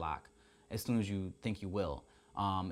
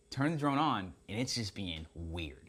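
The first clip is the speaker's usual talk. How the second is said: usual speaking voice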